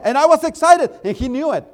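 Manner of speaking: ordinary talk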